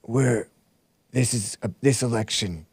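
lightly voiced drowsy old politician; a hint of a tired, mumbling old man; Sleepy Joe impression. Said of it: "This is my Sleepy Joe impression".

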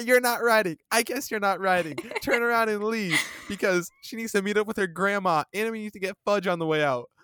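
put-on high, loud snotty teen voice; mock teen girl. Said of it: "And she's like".

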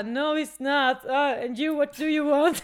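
loud, tipsy cocky bartender. Retold or imitated. Drunk bartender being cocky with guests, loud. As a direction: snap back cheekily, loud. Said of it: "Så jävla kaxig".

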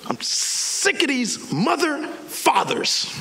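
slow, drawn-out high seething; frustrated. Acted. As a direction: spell out your exasperation word by word. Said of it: "I know it was a frustration".

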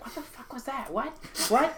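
a hint of startled goblin muttering; goblin voices. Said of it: "a bunch of goblin voices just like".

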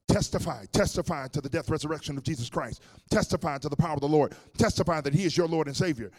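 loud, high, driving preacher's command; shouted exhortation. Retold or imitated. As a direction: urge her on at full preaching force. Pastor commanding her to testify.

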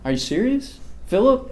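incredulous teacher asking his student; exasperated Jesus. Acted. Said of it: "Jesus is like".

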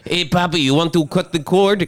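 high, loud mock doctor; mocking doctor at the birth. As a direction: ham up a doctor offering the cord.

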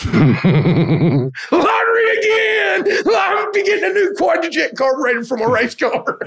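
high whooping laugh-shout. Real, not acted. Teller celebrates getting the raise, laughing shout. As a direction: crow like you hit the jackpot.

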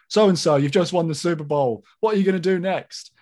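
mock post-game interviewer; a lightly hammed-up sideline reporter; sports journalist. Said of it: "the commercials all went something like this".

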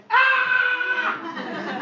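high, loud cat screech; cat attack yell. Acted.

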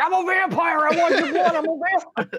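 high, loud, manic vampire cry; hammed-up unhinged vampire shouting; Nick Cage. Cohost imagines Cage bursting in as a vampire, manic. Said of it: "Nick Cage from Vampire Kitchen came".

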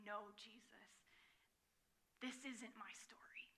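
whispered inner refusal; hushed resistance. Retold or imitated. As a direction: breathe it like a private protest.

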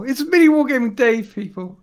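gushing over a hero; geeking out. Real, not acted. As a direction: starstruck fan excitement. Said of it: "I'm geeking out right now".

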